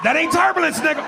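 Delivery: high-pitched passenger's retort; high mock holler. Passengers calling out on plane.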